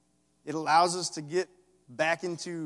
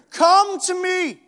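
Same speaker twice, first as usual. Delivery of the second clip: high, loud, slow yell; 15 dB louder, much slower than usual. Acted. Jesus yelling in the listener's ear, loud slow shout. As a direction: yell it right into their ear. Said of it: "yelling in your ear".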